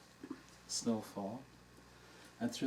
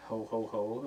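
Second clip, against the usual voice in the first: normal talking voice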